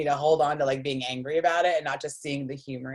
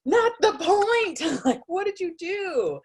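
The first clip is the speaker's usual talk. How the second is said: slow, high-pitched indignant yell; acted exasperated demand; mock exasperation; 4 dB louder, slower than usual. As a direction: draw it out in hammed-up frustration. Exasperated at laughing friends.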